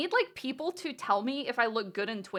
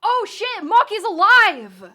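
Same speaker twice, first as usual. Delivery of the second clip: high, loud, stretched-out gasp of shock; shocked shout; 9 semitones higher, 14 dB louder, slower than usual. Real, not acted. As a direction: yell out the news in disbelief. Sudden twist in the game.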